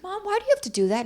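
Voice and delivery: high, fast retold exasperation; irritated. Daughter challenges Mom over her remark, irritated. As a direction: imitate a daughter's irritated, high-pitched protest. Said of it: "I got irritated".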